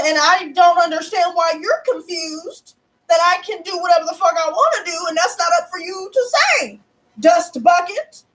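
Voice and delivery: high, insistent retold telling-off; putting foot down. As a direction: reenact laying down the law, high-pitched, drawing out the final insult. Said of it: "the foot down like that".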